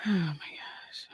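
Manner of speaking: breathy whisper under the breath. Real, not acted. Alone reading comments, whispering in disbelief. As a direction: drop to a stunned whisper.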